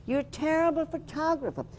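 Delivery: high-pitched imitated Scottish rebuke; a hint of Scottish in a high, scolding voice; Scottish accent. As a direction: complain in a lightly Scottish voice, pitched well up. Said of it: "in his Scottish accent".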